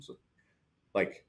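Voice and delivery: ordinary delivery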